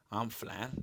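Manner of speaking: whispered aside. Real, not acted. Co-host quietly boasting about his diet, whispered aside. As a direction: say it under your breath.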